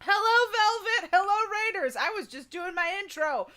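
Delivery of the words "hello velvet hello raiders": high shrieking, loud raid welcome; excited shrieking. Real, not acted. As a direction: squeal at the top of your voice. Second raid arrives, shrieking welcome.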